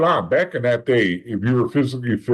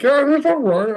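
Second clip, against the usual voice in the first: fake cheerful; 3 dB louder than usual. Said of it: "And I'm like".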